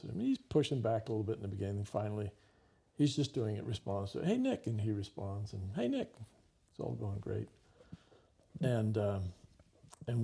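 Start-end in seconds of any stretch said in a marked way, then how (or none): 4.3-4.7 high sing-song call of the name; calling the new name. Retold or imitated. Madame Chameau calling the boy by his new name, high and lilting. 5.8-6.1 high sing-song call of the name; calling the new name. Retold or imitated. Madame Chameau calling the boy by his new name, high and lilting.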